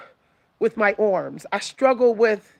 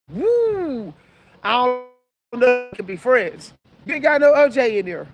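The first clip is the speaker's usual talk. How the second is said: sassy mock disbelief. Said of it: "I be like".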